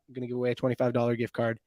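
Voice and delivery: ordinary delivery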